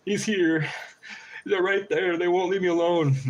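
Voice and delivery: playing frantic and haunted for the camera; high panicked mock fear. Recording a video about the crows, mock panicked and high. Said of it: "I record a video like".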